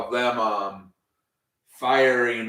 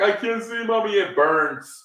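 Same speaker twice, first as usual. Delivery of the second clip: imitating a blinded kid calling for mommy, hammed-up; mock whiny kid; 8 semitones higher than usual. Mocking Dominic crying to his mommy after the mist, high whiny voice.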